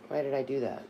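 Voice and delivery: ordinary talk, nothing marked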